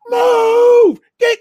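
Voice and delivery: loud barked order, high and forceful; drill sergeant yell. Retold or imitated. Sergeant yelling during live-fire drill.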